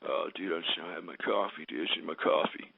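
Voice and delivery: very low, mumbled mock voice; mocking coffee whiner. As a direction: drag it out, dopey and pathetic. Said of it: "is because".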